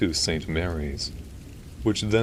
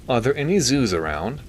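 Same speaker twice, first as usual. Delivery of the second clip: high, louder nasally question; Milton; 7 semitones higher, 5 dB louder than usual. A narrator's reading. Doctor seeking an explanation from the priest, nasally. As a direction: ask through the nose, pitched up. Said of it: "He had a nasally voice".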